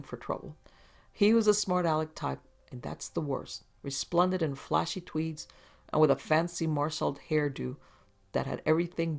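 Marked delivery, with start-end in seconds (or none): none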